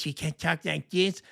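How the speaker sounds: mocking little evil-sounding voice; Becerra's weird evil voice. Host mimicking candidate Becerra, evil little voice. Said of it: "little weird, like, evil sound in his voice".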